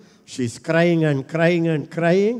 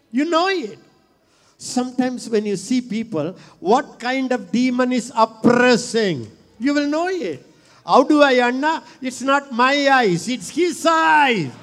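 preaching at full force; 7 semitones higher, 3 dB louder than usual. Real, not acted. Preacher to his congregation, fervent high preaching. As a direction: cry it out to the church, climbing.

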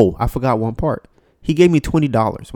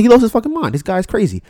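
higher and louder, fed up; louder, higher exasperated repeat; exasperated outburst; 8 semitones higher, 4 dB louder than usual. Retold or imitated. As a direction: blurt it out in exasperation. Reacting to ringing, escalating.

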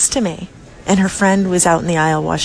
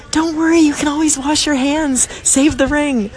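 reassuring; 8 semitones higher than usual. Retold or imitated. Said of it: "I was like".

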